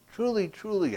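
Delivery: "Truly, truly" started high and stressed, falling; emphatic truly. Shown as a demonstration. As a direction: drive home "truly, truly" from high. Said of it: "Take this to heart".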